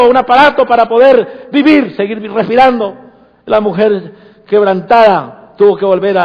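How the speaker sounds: loud, high fervent preaching; preaching at full force. Acted. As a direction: preach it out to the whole church.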